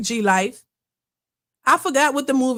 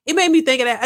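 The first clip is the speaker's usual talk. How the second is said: ordinary talk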